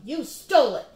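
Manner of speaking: shouted. Acted. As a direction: throw the blame at him. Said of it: "he shouted".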